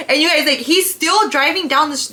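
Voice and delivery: ordinary delivery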